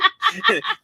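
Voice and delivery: laughing. Retold or imitated. Mock kids laughing.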